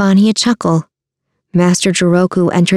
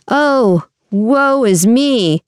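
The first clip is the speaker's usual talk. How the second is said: higher-pitched, drawn-out mock woe; theatrical lament; 5 semitones higher, much slower than usual. A narrator's reading. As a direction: wail it slowly, hamming up the misery. Theatrical lament by scheming father.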